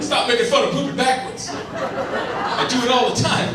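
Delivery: high, whiny mock-offended voice; mad guy. Heckler complaining to the comic, high mock-whiny. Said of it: "He's like".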